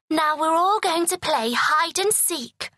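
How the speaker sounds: a queen announcing games; calling out. A narrator's reading. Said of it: "called the queen".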